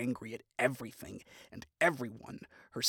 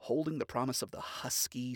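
ordinary delivery

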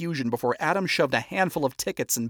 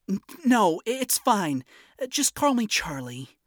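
high, stammering and unsure; flustered stammer; 8 semitones higher than usual. Acted. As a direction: stumble over it nervously.

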